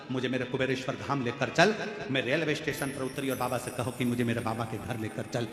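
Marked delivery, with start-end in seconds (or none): none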